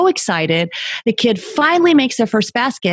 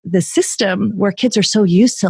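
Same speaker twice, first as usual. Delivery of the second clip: ordinary delivery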